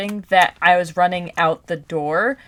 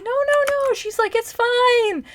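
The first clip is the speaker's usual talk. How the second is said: much higher, sing-song soothing teacher voice; reassuring teacher. Retold or imitated. Kind speech teacher comforting her sobbing student, high and soothing. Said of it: "And she's like".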